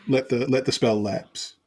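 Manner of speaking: ordinary talk, nothing marked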